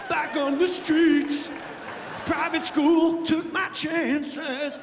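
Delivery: slow, swelling triumphant narration; mock epic. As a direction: ham it up like a Rocky montage. Epic Rocky movie run narration.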